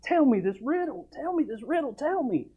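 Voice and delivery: high whiny nagging; mock weeping plea. Preacher mimics the crying wife nagging Samson. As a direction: nag like a tearful wife. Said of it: "She wept to him".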